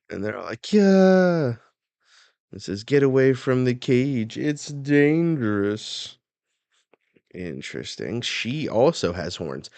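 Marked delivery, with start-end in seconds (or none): 0.7-1.6 loud, high cheering yell; crowd cheer. Acted. Acted crowd cheering the show. 2.8-6.2 loud, high-pitched warning; acted warning shout. Acted warning at the giant's cage.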